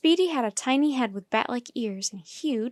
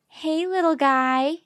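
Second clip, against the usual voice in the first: put-on high, slow baby talk; sweet, drawn-out greeting to a small animal; cooing greeting; 5 semitones higher, 5 dB louder, slower than usual. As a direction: coo hello to a tiny pet. Annie greets the tiny bush baby.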